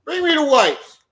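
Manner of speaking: real loud, high-pitched parental outburst; angry scolding. Real, not acted. Dad scolds child over hot sauce on baby, angry yelling.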